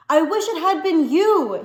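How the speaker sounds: high, loud grief-stricken scream; furious scream. A narrator's reading. A son screaming at his father, wishing him dead. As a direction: hurl the words at him. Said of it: "he screamed".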